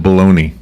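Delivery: Bologna said as "baloney"; everyday pronunciation. Shown as a demonstration. Host names an Italian town the American way, Bologna as "baloney". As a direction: say Bologna with a "-nee" ending. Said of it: "But it's pronounced Bologna?".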